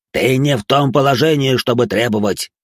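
loud indignant merchant ranting. A narrator's reading. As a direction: rant at them, puffed up. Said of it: "разорялся господин Саванн". (возмущённо разоряясь)

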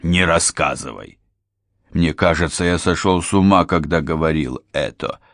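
ordinary delivery, nothing marked

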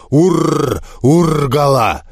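slow, drawn-out orc bellow; orc roar. Acted. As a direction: roar it like a huge orc warrior. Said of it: "и взревел".

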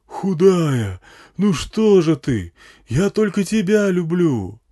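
slow, high, loud pleading; drawn-out amplified wail to a lover; megaphone love plea. A narrator's reading. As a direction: declare love through a bullhorn. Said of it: "оратор не видел".

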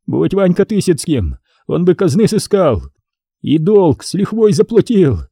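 put-on high, whining old man pleading; sobbing merchant explaining himself to the prince; trembling mutter. A narrator's reading. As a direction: justify yourself with shaking lips. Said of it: "забормотал трясущимися губами".

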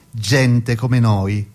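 normal talking voice

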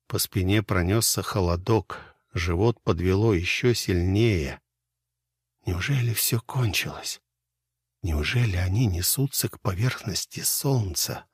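ordinary delivery, nothing marked

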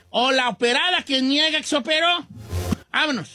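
ordinary delivery

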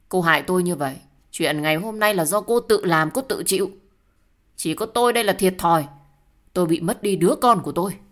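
loud, heated blame; angry accusation. Acted. Blaming the other for losing the child, angry. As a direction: throw the blame hard and loud.

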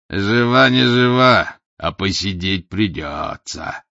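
slow mocking gloat; a hint of a gloating bass. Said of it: "злорадствовал бас". (злорадный бас, слегка обозначенный)

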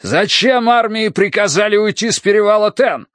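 high, anguished outcry; pained shout. A narrator's reading. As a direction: cry out the question in pain. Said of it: "крикнул седобородый командир, и Тай услышал боль в его голосе".

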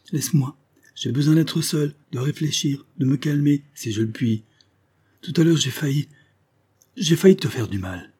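high-pitched, strained self-restraint; agitated dismissal. Acted. Husband begging to be left alone, agitated. Husband breaks off, barely controlling himself.

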